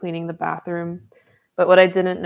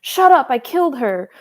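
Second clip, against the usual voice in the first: mock yell; 7 semitones higher, 7 dB louder than usual. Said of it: "to them was like".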